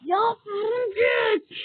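long frightened yell at the spider, high and loud; drawn-out panicked cry at a spider; scared shout. Real, not acted. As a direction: shriek it out in real fright. Startled by a spider in game.

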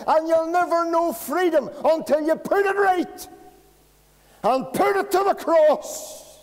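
loud, high, ringing preacher's cry; full-force preaching. Acted. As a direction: hammer each question at the congregation.

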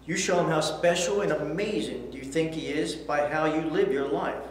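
normal talking voice

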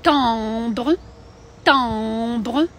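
nasal French "Timbre" with a rising end. Shown as a demonstration. Teacher models "Timbre" for live-stream students. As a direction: say "Timbre" through the nose, French style. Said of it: "давайте приклади дивимося, як вимовляються".

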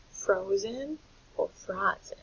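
FROZEN as froh-zen, then frah-zen; long O then short ah. Shown as a demonstration. Teacher offering two choices, contrasting pronunciations. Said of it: "it's a short vowel, ah".

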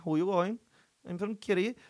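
exaggerated song-like Kerry speech; sing-song Kerry accent. Imitating Kerry emigrant fifty years in Australia, sing-song Kerry accent. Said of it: "barely graspable fucking kind of song".

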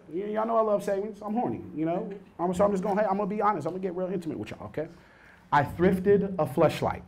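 normal talking voice

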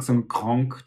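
"krank" with a vowel between A and O; "krank" mit Laut zwischen A und O; Austrian vowel. Shown as a demonstration. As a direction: say "krank" like "kronk". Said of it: "kein A und kein O".

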